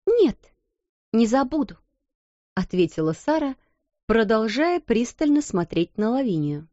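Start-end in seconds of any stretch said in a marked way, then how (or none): 0.1-1.8 firm reply. A narrator's reading.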